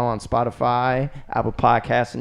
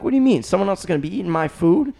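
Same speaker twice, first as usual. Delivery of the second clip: put-on skeptical kid; 6 semitones higher, faster than usual. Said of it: "I'm like".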